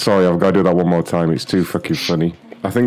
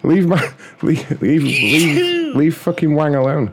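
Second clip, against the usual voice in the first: higher-pitched, hammed-up huffy protest; mock indignant protest; 8 semitones higher than usual. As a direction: play the offended Wang, half-laughing. Mock outrage defending his character.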